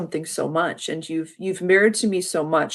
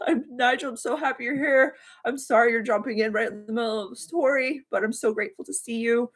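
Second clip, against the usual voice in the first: still teary; 6 semitones higher than usual. Real, not acted. Greets viewer while still tearful.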